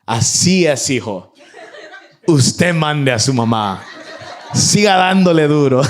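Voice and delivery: loud mock approval, laughing through it; sarcastic grandfather. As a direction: egg the boy on with fake praise, amused. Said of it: "y sarcásticamente le dice".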